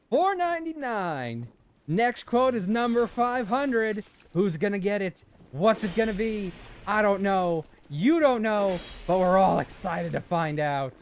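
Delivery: hammed-up countdown announcer; announcer hype. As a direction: build suspense like a host. Hyping the 500th quote.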